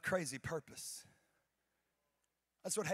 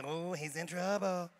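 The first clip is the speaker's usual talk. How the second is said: schoolkid taunt; 5 dB louder, slower than usual. Retold or imitated. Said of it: "Like I'm in elementary school".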